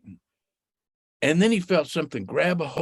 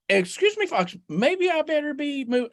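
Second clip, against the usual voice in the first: high, hesitant rabbit voice edging away; nervous Rabbit; 9 semitones higher than usual. Acted. Rabbit edges away from Fox, high and nervous. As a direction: back off politely in a high little voice.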